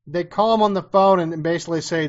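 ordinary talk, nothing marked

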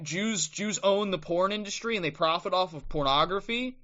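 ordinary delivery

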